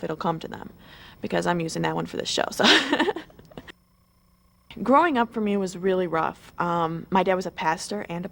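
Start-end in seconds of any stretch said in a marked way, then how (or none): 2.7-3.2 a quick, high little laugh; short laugh. Real, not acted. Model hopeful laughs after sharing her secret, a quick high giggle.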